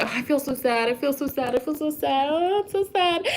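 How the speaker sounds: softly whined, rising self-pity; mock moping. A friend mimics herself moping, soft and whiny. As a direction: pout and whine about being sad. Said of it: "you're just like".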